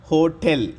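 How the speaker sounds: Hotel as ho-TEL, the "tel" pressed; stress on second syllable. Shown as a demonstration. Teacher demonstrating for learners, hotel with stressed "TEL". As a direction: lean firmly on the "te" in hotel. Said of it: "టేని నొక్కి పలకాలన్నమాట".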